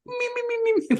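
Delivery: tiny, very high repeated snoozing squeaks; mock cartoon snore. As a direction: imitate a comic sleeper in soft, high peeps. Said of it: "immediately just falls onto a bale of hay and goes".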